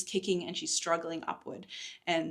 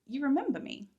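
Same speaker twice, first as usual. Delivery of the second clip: higher, a mild startled question; surprised; 7 semitones higher than usual. Acted. Cadence taken aback by Morrigan, mildly surprised. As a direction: Cadence caught off guard, a touch surprised. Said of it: "surprise flickered briefly across her face".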